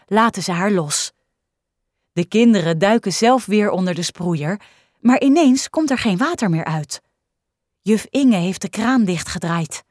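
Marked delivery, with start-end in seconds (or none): none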